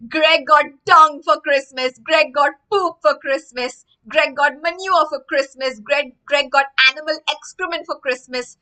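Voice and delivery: loud singsong jeering; taunting kids. Acted. Children jeer at Greg in the street, loud taunting. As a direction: taunt him like schoolkids.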